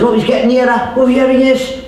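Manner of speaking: high-pitched excited commentary; mounting anticipation. Acted. Watching Jesus approach the blind beggar, high and excited. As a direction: voice lifted as he comes closer.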